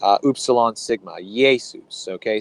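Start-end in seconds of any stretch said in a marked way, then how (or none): 1.2-2.1 "Jesus" starting on a y glide; Greek pronunciation. Shown as a demonstration. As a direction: say Jesus the Greek way, "Yay-soos". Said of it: "it would be pronounced Iesus".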